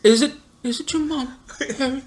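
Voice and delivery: high, soft mocking taunt. Friend teasing about Harry's mom, high mocking voice. Mock Harry Potter quote.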